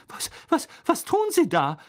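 stammering, high-pitched disbelief; high, flustered demand; flustered shock. A narrator's reading. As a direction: stumble into the question, aghast. McGonagall appalled, questions Moody.

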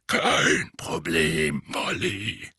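low, slow grizzled voice; a gruff veteran answering; gruff old auror. Acted.

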